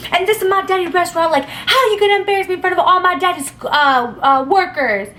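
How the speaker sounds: fast, high indignant mock whine; whiny yelling guy. Spoiled guy yelling at the girl about his daddy's workers, fast high whine. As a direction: rush it out high like a spoiled kid. He yells at her.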